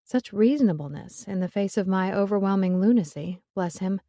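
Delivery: plain reading voice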